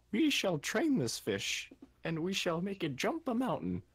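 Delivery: rally the crew with mock grandeur; mock rallying speech. Player rallying party to train the fish, grand and high.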